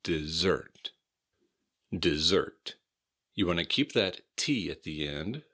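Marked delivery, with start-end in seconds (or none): 0.0-2.8 dessert with the S's voiced as Z; Dessert, Z sound and stress on the second syllable. Shown as a demonstration. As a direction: say Dessert with a buzzing Z in the middle. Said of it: "have a Z sound".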